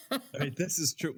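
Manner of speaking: laughing. Real, not acted. Laughing while answering.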